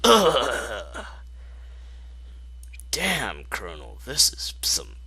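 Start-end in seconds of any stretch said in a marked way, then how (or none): none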